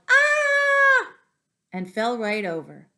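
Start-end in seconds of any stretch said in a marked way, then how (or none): 0.1-1.1 high, loud shriek of fright; father startled by his son, shrieking; startled yell. Acted. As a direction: scream like you've been ambushed. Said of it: "The lady yelled".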